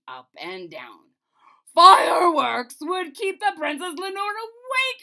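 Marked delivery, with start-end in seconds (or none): 1.7-5.0 loud, high furious shout; the king in a rage at the mathematician; raging king. A narrator's reading. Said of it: "The king flew into such a rage".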